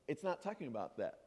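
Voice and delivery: ordinary delivery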